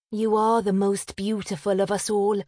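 sighing. A narrator's reading. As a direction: concede it with a gentle sigh. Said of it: "said with a sigh".

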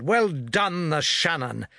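ordinary delivery

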